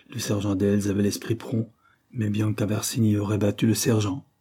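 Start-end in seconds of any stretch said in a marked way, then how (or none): none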